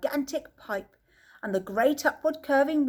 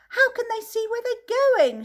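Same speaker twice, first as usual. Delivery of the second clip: shrieking; 13 semitones higher, 6 dB louder than usual. A narrator's reading. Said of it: "shrieked Violet Beauregard".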